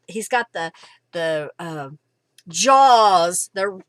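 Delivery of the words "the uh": normal talking voice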